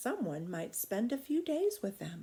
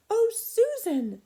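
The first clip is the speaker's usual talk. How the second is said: high, loud and drawn out; a little girl running up to her friend with big news; excited call; 10 dB louder, slower than usual. Acted. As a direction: call out to your best friend, bursting with news. Child calls to her friend.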